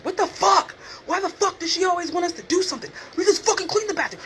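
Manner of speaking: high, loud mock outburst; imitating a screaming coworker. Coworker ranting about bathroom duty, high mock yell. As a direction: play him yelling in front of the inmates, hammed up. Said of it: "screams out loud in front of the inmates".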